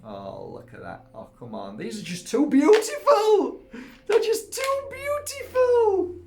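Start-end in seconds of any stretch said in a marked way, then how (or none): 1.8-6.1 high and loud, laughing into a teary squeal; half laughing, half crying with joy; squealing rapture, breaking up; overwhelmed delight. Real, not acted. Overwhelmed by crystals, laugh, cry.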